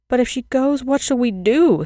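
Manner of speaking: put-on frightened children begging the girl; cry of despair. A narrator's reading. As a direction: wail it out as if all is lost. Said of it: "they cried in despair".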